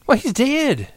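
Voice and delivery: high startled cry; shocked to find the pup dead; cried out. Acted. Curly's wife cries out at the dead pup, high. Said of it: "she cried".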